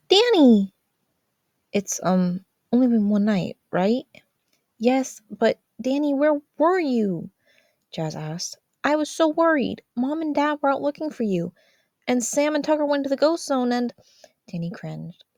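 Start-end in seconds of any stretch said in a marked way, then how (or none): 0.1-0.7 sudden high, loud cry of his name; startled cry. Acted. Sister waking to find her brother back, high startled cry. 1.7-7.3 high, slow, anxious sister's voice; an older sister fretting, voice pitched high; worried sister. Acted. Sister questioning her missing brother, high and worried. 8.8-13.9 put-on an older sister fretting, voice pitched high; worried sister. Sister questioning her missing brother, high and worried.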